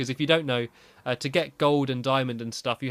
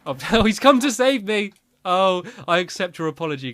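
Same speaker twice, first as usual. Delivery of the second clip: laughing; 7 semitones higher, 9 dB louder than usual. Real, not acted. Laughing as dog returns.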